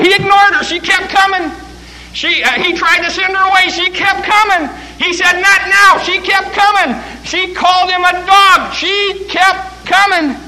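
fiery preacher crescendo; preaching at full force. Acted. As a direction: build the sermon to a shouted peak.

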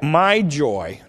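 "my" drawn out and hit firmly in "my joy"; stress on MY. Shown as a demonstration. Preacher points listeners to whose joy it is, stressing "my". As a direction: lean slowly on the word "my". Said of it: "notice the word my".

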